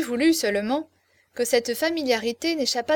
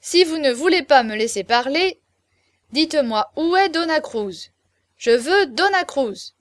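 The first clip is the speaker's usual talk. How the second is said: raised, loud insistence; shouting demand; 4 semitones higher, 6 dB louder than usual. A narrator's reading. Chaverny demanding Dona Cruz from the guests, a raised shout. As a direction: demand it in a louder voice. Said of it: "cria Chaverny".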